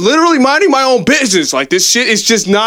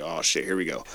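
under-breath dread; 16 dB quieter than usual. Retold or imitated. Inner thought as a stranger starts talking.

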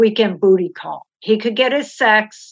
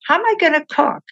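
repeated plea jumping much higher; exasperated repeat; 8 semitones higher, faster than usual. Retold or imitated. Wife repeating her plea to husband, exasperated and much higher. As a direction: push the question up high in disbelief.

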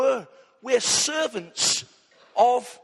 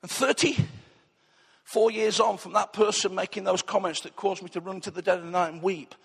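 usual speaking voice

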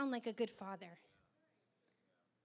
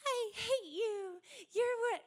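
high, slow cooing voice with nasty words; sweet baby talk; 9 semitones higher, 4 dB louder than usual. Retold or imitated. Preacher mimics baby video talk in a cooing sweet tone. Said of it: "they are using this sweet and lovely tone".

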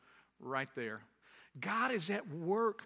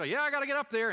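hurried insistence; 9 semitones higher, 6 dB louder, faster than usual. Retold or imitated.

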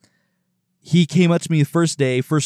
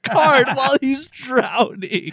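slowed and pitched up, breaking into laughter; laughing through it; 12 semitones higher, 3 dB louder, slower than usual. Real, not acted. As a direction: lose it laughing mid-sentence. Laughing at drowning man story.

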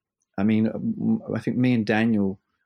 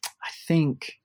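whisper; 5 semitones higher, 3 dB quieter than usual. Real, not acted. Hushed aside before recalling fact.

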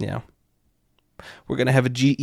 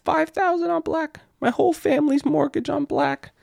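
high, excited betting voice; giddy high-roller shout; high-pitched gambler rush. Retold or imitated. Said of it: "You're like".

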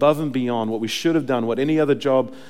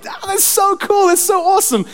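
very high-pitched, hammed-up excitement; mock gushing enthusiasm; 7 dB louder than usual. Preacher imitates a believer moving on quickly, gushing. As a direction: squeal it like an easily thrilled fan.

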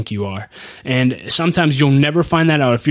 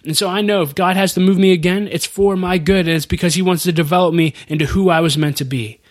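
ordinary delivery

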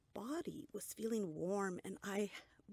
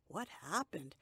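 plain reading voice

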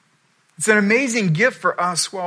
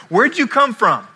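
Pilate demanding; 6 semitones higher, 6 dB louder than usual. Retold or imitated. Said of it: "he goes".

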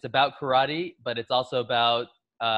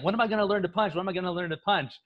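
higher-pitched mock complaint; hammed-up impatience, pitched up; impatient pupil; 6 semitones higher, faster than usual. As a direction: imitate a kid pestering his teacher. Voices impatient pupil Daniel.

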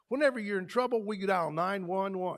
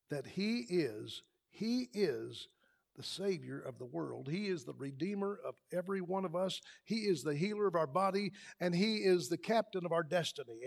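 tender; 3 semitones lower, 6 dB quieter than usual. Real, not acted. Tender declaration about the Savior.

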